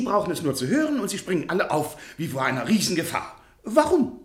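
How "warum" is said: high, loud puzzled outburst; baffled cry. Acted. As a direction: demand an answer in disbelief. Professor demands meaning of Protja. (verblüffter Ausruf, hoch und laut)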